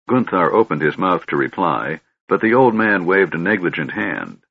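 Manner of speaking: ordinary delivery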